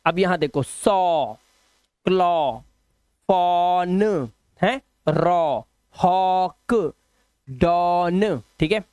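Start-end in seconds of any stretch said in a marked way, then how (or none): 0.6-8.4 सॉ, क्लॉ, फॉन, रॉ, हॉक, डॉन with long "aw"; "aw" sound. Shown as a demonstration. Teacher reading examples for pupils, drawn-out "aw". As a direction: sound out each word apart, holding the "aw". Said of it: "ओ से ही करना है".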